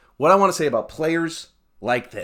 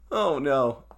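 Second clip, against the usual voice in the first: ordinary delivery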